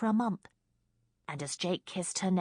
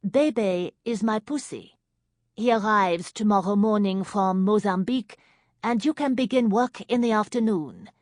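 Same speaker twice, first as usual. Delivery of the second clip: put-on thick French accent; 5 semitones higher, 7 dB louder than usual. A narrator's reading. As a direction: introduce yourself in smoky French. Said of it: "in a French accent as thick as nightclub smoke".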